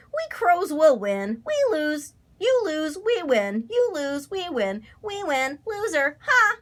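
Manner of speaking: high, sing-song gloating; cheering taunt. A narrator's reading. Crows taunting the scarecrow as losers, high cheering jeer. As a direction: chant it at him, high-pitched. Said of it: "cheered Grandfather Crow".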